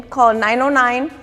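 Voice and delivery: ordinary talk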